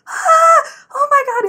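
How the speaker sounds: very high, loud squeal of disbelief; mock excited shriek. Cousin hearing about the star's comment, mock excited shriek. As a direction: re-enact screaming with fangirl excitement.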